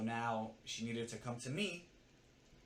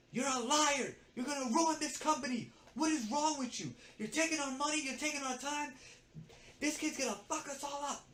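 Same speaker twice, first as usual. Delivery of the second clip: very high mock-screeching tirade; yelling; 13 semitones higher, 4 dB louder than usual. Coworker tearing into assistant mid-task, yelling. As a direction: imitate a coworker yelling accusations, pitched way up. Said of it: "She starts yelling at me".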